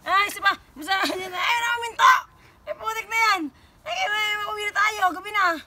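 high, loud excited cries; excited squeal. Real, not acted. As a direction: squeal it out, thrilled. Excited high exclamations with dog. (masiglang tili)

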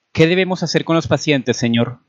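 high-pitched, timid question; fearful. A narrator's reading. Doctor asking his superior what to do, fearful. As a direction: ask anxiously for orders. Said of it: "preguntó Collins temeroso".

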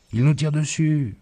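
high, alarmed cry of a scared woman; frightened wife. A narrator's reading. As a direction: blurt out the danger in fright. Panicked wife, shots fired. (cri aigu d'une femme effrayée)

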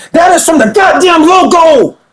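hyped shout at the replay; excited shout. Real, not acted. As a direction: yell it like you can't believe the shot. Disbelief at a logo three.

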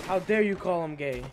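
loud mock indignation; mock outrage. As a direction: act loudly affronted. Mock outrage at robot chatter.

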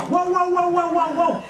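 high, loud playful woof woof; a storyteller's cartoon dog barks; dog barking. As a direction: bark like a little dog. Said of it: "le escuchaba".

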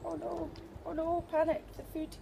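high-pitched chatter to a dog; high, teasing mock panic; playful dog talk. Real, not acted. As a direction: pitch it right up, playing with your dog.

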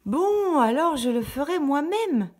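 voix de poule très haute et forte; la poule s'agace et décide; poule excédée. A narrator's reading. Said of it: "dit la petite poule rousse". (la poule agacée décide de tout faire seule)

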